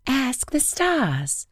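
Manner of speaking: high, slow wind voice; slow airy wind voice. Acted. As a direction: draw the words out like wind. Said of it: "the wind replied".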